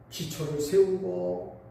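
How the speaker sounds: normal talking voice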